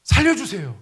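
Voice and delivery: sinking man crying out; cry for help. Acted. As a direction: call out for rescue. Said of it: "막 소리 지르는 거예요".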